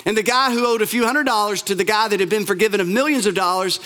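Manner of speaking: ordinary talk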